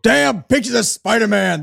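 a hint of a New York record exec; New York tough guy. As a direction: quote it like a mild Northeast sleazeball. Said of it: "I can do a voice like that".

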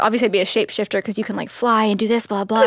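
quick, eager rattling off; fast rattled-off answer. Retold or imitated. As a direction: rush it like an obvious answer. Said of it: "and I was like".

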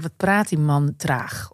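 slow, low mock of a sluggish talker; mock slow drawl. Mocking a man who talks slowly, in a slow drawl.

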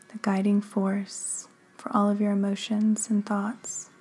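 unhurried meditation guide, long pauses between phrases; measured, calming delivery with room to breathe; guided meditation. Acted. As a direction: guide the listener gently into stillness, phrase by phrase.

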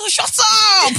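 high, loud playful yell; mock shout. Retelling telling a friend to shut up, loud mock shout.

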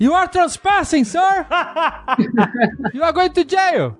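high-pitched mock official warning; hammed-up guard, voice pitched right up; American authority figure. American guard threatening a trespasser with jail, mock-authoritative. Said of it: "nos Estados Unidos é".

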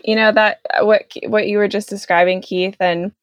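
ordinary talk, nothing marked

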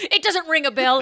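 ordinary talk, nothing marked

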